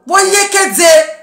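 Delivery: slow, high, loud cry; drawn-out shout. Real, not acted. Calling out to the critics in a slow loud cry. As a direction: stretch it out as a shout.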